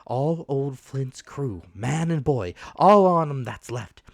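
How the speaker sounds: put-on rough, loud old pirate captain; hoarse pirate captain voice; old sea captain. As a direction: growl it out like a dying buccaneer. Captain's dying rant.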